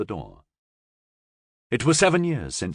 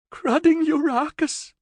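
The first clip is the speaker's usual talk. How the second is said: whimper; 3 dB louder than usual. Acted. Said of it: "Florian whimpered".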